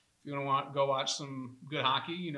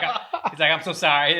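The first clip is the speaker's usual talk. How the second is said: put-on terrible Boston accent; 3 semitones higher, 14 dB louder than usual. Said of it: "terrible Boston accent".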